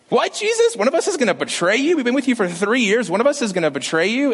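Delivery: high, incredulous, hammed-up shock; mock disbelief. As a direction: squeal it like a stunned disciple. Disciples shocked at betrayal news.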